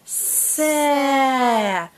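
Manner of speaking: "Sa" with a long hiss and held vowel; read slowly. Shown as a demonstration. Teacher reading with a child, drawn out. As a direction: stretch "Sa" out slowly. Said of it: "Let's read them quickly".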